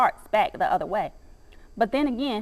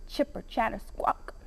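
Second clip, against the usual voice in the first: squirrel chatter; 9 dB quieter than usual. Acted. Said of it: "He screeches".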